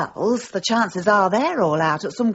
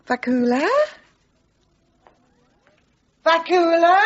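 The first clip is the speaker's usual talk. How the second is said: calling louder, higher each time; rising calls for Vakula; 10 semitones higher, 5 dB louder than usual. Acted. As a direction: call out for her son. Calling for son through house.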